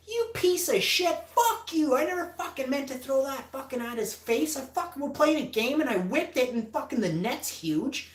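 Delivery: high-pitched, heated rant; angry yelling back. Retold or imitated. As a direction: retell a furious self-defence at full tilt. Retold yelling back at screaming man.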